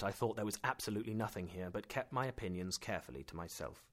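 usual speaking voice

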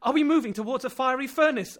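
high and loud alarmed call. Acted. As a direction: shout the question through the heat. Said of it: "I called out".

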